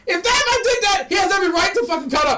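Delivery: loud, high and fast outburst; real heated rant; heated shout. Real, not acted. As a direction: fire it off like you're fed up. Heated argument about allergies.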